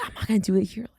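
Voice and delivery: low, soft self-restraint; restrained aside. Retold or imitated. Friend recalling not raging in his hometown, restrained. Retelling holding back her rage.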